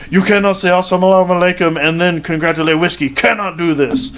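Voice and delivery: put-on a light Russian-Dagestani accent, stern; imitating Khabib. Khabib scolding the reporter, light imitated accent. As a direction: lecture him like Khabib scolding Conor. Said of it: "And Khabib goes".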